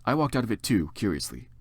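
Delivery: usual speaking voice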